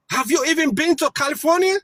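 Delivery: ordinary talk, nothing marked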